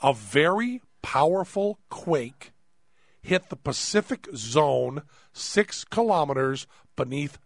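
slow, deliberate, word by word; slow, careful reading. Shown as a demonstration. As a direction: read it slowly for them to check. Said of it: "You want to listen very carefully to this".

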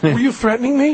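high, meek question without a shout; really high voice. Shown as a demonstration. Asking the stranger if it was a threat, high and meek. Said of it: "like, really high voice".

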